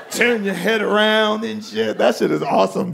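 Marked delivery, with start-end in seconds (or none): none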